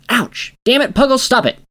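high, loud yelp of pain; pained yell. Acted. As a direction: yell at the biting pig. Bitten by pig.

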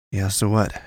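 ordinary delivery, nothing marked

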